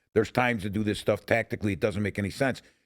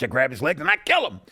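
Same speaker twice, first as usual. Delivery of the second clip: normal talking voice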